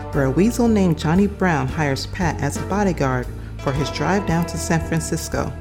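ordinary delivery

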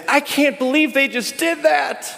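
high, indignant disbelief; lightly hammed judgment; mock judgmental. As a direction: play the self-righteous onlooker. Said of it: "look at others and say".

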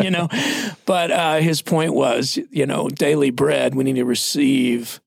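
usual speaking voice

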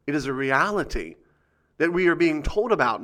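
usual speaking voice

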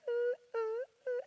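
high keening ooh imitating a shrill electronic tone; hammed-up high whining ooh. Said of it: "That tone".

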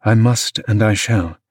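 ordinary delivery, nothing marked

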